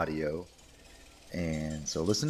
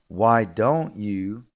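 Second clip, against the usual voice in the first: "don't" lifted in a slow rising "Why don't you?"; drawn out friendly suggestion; teacher models a friendly suggestion; slow model phrase; 6 dB louder, much slower than usual. Shown as a demonstration. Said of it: "more friendly way".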